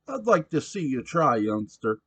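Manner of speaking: put-on loud, high rowdy drunk voice; a belligerent barfly demanding his beer; drunk pushy patron. Sam taunting Simon.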